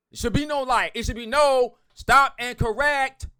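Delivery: slow, loud, stressed rule; emphatic outburst. Real, not acted. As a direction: insist it hard, like laying down the law. Ranting about wrong grammar posts.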